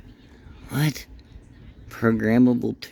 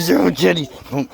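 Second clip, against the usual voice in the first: excited; 6 semitones higher, 4 dB louder than usual. Real, not acted. Greeting excited dog.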